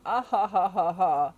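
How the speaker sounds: a hammed-up gloating laugh; mock chuckle. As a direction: chuckle like a smug villain.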